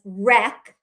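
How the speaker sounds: rec as a short, closed REK; closed first syllable. Shown as a demonstration. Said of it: "closing in the first syllable".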